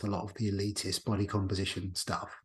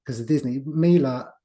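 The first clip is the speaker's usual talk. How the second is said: flustered, half-laughing, a bit louder and higher; laughing through tears; 4 semitones higher, 7 dB louder than usual. Real, not acted. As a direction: pull yourself together through a teary laugh.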